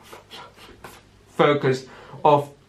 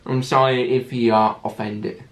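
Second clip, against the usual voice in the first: usual speaking voice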